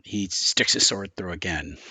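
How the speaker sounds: ordinary talk, nothing marked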